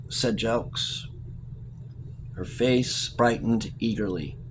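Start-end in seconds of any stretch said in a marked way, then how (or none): none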